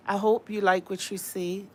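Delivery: ordinary delivery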